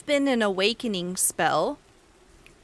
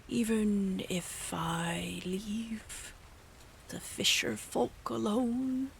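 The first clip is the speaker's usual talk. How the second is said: whispering; 5 dB quieter, slower than usual. Acted. GM whispered creature scene.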